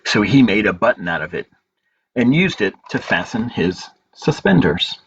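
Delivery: story narration. A narrator's reading. Librarian reading a picture book to children, gentle storytelling.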